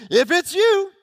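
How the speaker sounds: Peter. Acted. Peter calling to Jesus over the water, mildly yelling. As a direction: call it out as an eager question. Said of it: "why Peter yells out what he yells out".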